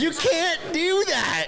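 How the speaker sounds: high, slow, stretched-out yell; drawn-out shout. Real, not acted. Streamer repeating the line at the screen, slow high yell. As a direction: savour every word of the yell.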